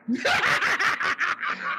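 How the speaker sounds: high, soft cackle; amused cackle; high laugh. Real, not acted. Laughing at story.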